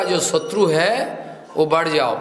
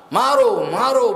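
battle cry; 4 semitones higher, slower than usual. Acted. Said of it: "बोल के".

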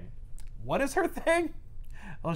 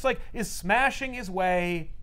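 ordinary talk, nothing marked

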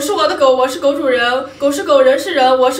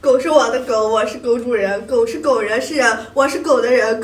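normal talking voice

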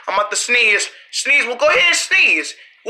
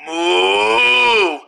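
sarcastic moo; 3 semitones lower than usual. Acted. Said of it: "I was being sarcastic".

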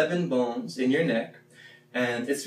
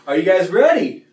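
hype call; 6 semitones higher, 9 dB louder than usual. Real, not acted. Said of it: "revving up the class".

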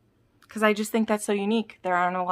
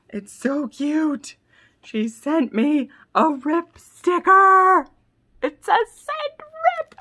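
slow, higher and louder gushing; high excited squeal; 9 semitones higher, 6 dB louder, much slower than usual. Real, not acted. Fan gushing to viewers over a Rip sticker, excited squeal.

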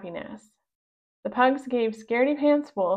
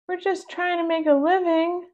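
pug voice; 8 semitones higher than usual. Acted. Pug character voice.

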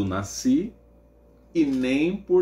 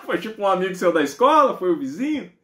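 amused questioning; 6 semitones higher, 5 dB louder than usual. Retold or imitated. Amused guesses in mother's voice.

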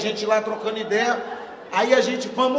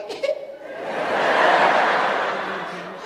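breathy laugh burst; 5 semitones lower than usual. Real, not acted.

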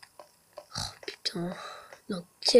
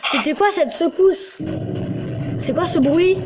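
alarmed; 5 semitones higher than usual. Real, not acted. Sudden quake startles him.

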